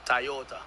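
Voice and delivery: Toyota said as "Tai-yota"; Jamaican pronunciation. Shown as a demonstration. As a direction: open Toyota with a "tie" sound, Jamaican style. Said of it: "we, we, we say".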